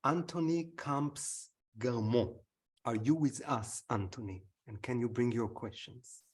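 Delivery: usual speaking voice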